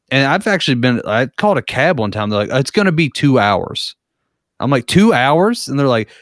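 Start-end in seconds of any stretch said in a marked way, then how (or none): 4.9-5.7 high, rising disbelief; retold stunned double-take, pitch jumping up; incredulous question. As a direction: echo it back in acted-out shock. Caller reacts to the wait.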